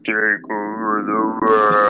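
slow sinister villain line; evil voice. Shown as a demonstration. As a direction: drag it out like a cartoon villain. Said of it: "like, an evil voice where it's like".

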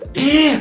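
gasp. Real, not acted. Gasp before news.

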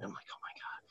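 very soft, voiceless gasp of words; stunned whisper. Retold or imitated. Seventh grader about to sneak out of class, stunned whisper. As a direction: whisper it under your breath, retelling.

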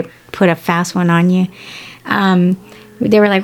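usual speaking voice

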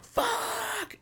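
very high, held-back falsetto yell; imitated scream, kept soft and sky-high; mock pain scream. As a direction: reenact agony as a thin, drawn-out squeal. Retold scream as nail is ripped.

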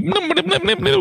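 high quick plucking noises; imitating fast guitar notes. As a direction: mimic rapid guitar picking. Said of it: "he starts going like".

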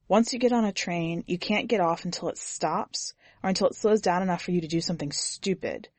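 ordinary delivery, nothing marked

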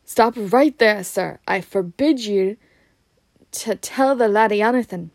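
loud, forceful prohibition; commanding. A narrator's reading. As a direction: order him to halt, firmly and loudly. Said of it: "he commanded".

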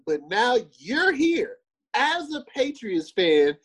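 high, drawn-out disbelief; incredulous challenge. Real, not acted. Co-host calling out a hypocritical Patriots fan, incredulous.

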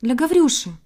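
mild bird-like shout; calling after someone. A narrator's reading. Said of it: "закричала ему вдогонку Синичка".

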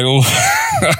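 short high laugh bursting out; real laugh burst. Real, not acted. Remembering wife's deadpan, short real laugh. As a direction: crack up briefly. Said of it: "I love that she totally deadpanned".

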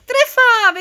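a high, loud triumphant yell; excited shout. Acted. Dad Honza cheers the dwarves' right guess, loud and high. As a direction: shout it like you finally won. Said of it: "vykřikl nadšeně táta Honza".

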